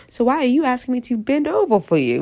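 ordinary talk

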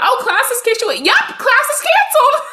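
squealing, high gleeful announcement; high, loud, thrilled imitation; excited high voice. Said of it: "the fact that you went".

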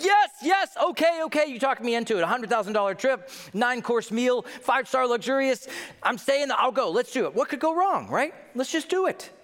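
high, excited giving in; eagerly won over. Acted. Guest talked into a luxury trip, eager. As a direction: build eager agreement, pitched well up.

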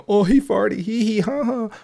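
high, giggly mock schoolkid voice teasing about a fart; childish coworker. Coworker mocks the vlogger's fart, high giggly childish voice. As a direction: squeak it out like a snickering kid. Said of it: "Y'all childish on the low".

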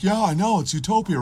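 high, eager mock agreement; mocked naive libertarian. Libertarian delighted the government is gone, mocked high voice. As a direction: agree brightly in a put-on voice.

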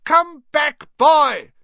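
very high, loud shout; alarmed shout. A narrator's reading. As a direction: shout after someone flying away. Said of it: "she shouted".

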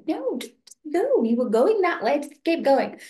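high, pleading mock shoo; shooing her along. Telling Chatterbox to keep walking past, high pleading shoo. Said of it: "I was like".

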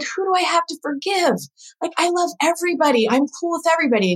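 high-pitched, quick defensive protest; incredulous protest. Retold or imitated. Woman protesting to her friend that she loves everybody, incredulous.

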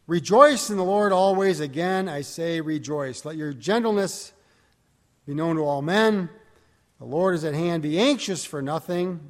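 scripture reading. Acted. Preacher reading Philippians aloud to the congregation, declaiming. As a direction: proclaim the text to the congregation.

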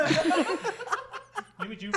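drawn-out laugh through food; laughing, mouth full. Real, not acted. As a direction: laugh it out slowly, chewing. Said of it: "we are eating while we are doing this".

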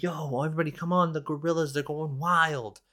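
high-pitched imitation of excited kids; mock freak-out. Kids calling everyone to the wild gorillas, hammed-up excitement. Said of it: "everybody starts freaking out".

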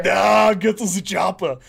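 put-on action-hero accent; Schwarzenegger-ish accent. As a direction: growl it like an action movie star. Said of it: "this accent going like this".

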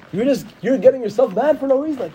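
normal talking voice

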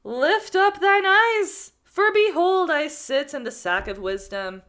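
slow, loud, high and grand proclamation; theatrical oracle. Acted. Man in the sack boasting to the student, theatrical and grand. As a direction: declaim it like a wise prophet. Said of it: "this needs to be really theatrical".

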